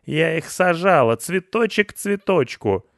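high, loud, aggrieved wail; indignant lament. A narrator's reading. Pensioner mourning the flowers she planted, indignant. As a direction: lament your ruined work in outrage. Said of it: "возмущалась Петровна".

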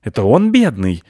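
loud, offended protest; mild squirrel indignation; indignant. A narrator's reading. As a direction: object as the wronged party. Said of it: "возмутилась белка".

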